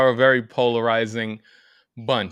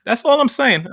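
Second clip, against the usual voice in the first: higher and louder, hammering it home; emphatic; 11 semitones higher, 5 dB louder than usual. Real, not acted. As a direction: burst out with it, much higher. Repeats closing line, much louder.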